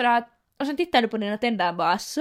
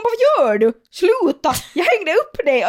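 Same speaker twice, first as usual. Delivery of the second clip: mock scolding; 4 semitones higher, 4 dB louder, slower than usual. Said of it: "Man bara".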